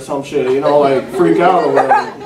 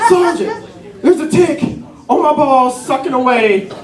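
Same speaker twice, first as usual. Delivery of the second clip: high-pitched mock panic; acted frightened plea in short bursts; scared, crying soldier; 8 semitones higher than usual. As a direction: whine it in hammed-up distress. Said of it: "I'm, like, scared".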